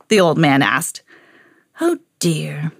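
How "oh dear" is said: whispered wizard fretting; wizard's whisper. A narrator's reading. Wizard realises the pie is missing, whispered. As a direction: breathe it as a worried whisper. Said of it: "said the wizard".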